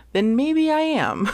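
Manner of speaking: pointed joke laughed out; laughing tease. Real, not acted. Teasing people who know who they are, laughing.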